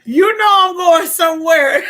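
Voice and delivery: mock the whiny line; loud mock wail. Wife mocking husband's whine to viewers.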